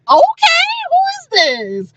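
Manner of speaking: very high, drawn-out mock surprise; intrigued reaction. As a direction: react as if floored by a newcomer, voice shooting up. Acts out impressed first reaction.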